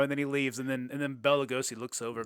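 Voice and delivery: usual speaking voice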